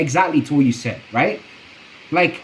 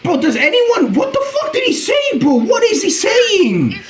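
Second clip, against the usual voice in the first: much higher, baffled shout at the clip; baffled outburst; 13 semitones higher than usual. Real, not acted. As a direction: yell in disbelief at what he heard. Can't understand the clip.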